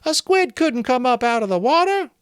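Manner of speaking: put-on high, loud boy's shout. A narrator's reading. A boy in the crowd shouting at Maurice, high and loud. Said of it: "Someone shouted".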